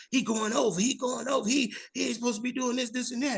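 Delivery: high, thin mock voice of a fellow comic griping from the wings; complaining comedian. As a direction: mimic the fussy comic muttering rules offstage.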